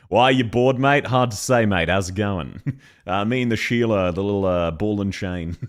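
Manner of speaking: hammed-up Australian accent. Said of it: "in an Australian voice accent".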